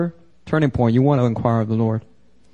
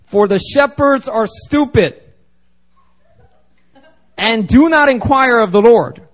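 scripture proclaimed; 8 semitones higher, 5 dB louder, slower than usual. Acted. Preacher reads scripture with force.

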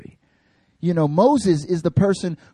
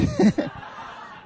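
high laugh. Real, not acted. Cracks up at Bernadette's squad.

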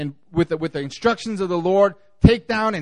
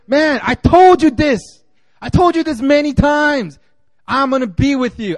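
a fed-up God repeating himself; exasperated rebuke; 8 semitones higher, 6 dB louder than usual. Retold or imitated. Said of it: "like a rebuke that God has to give".

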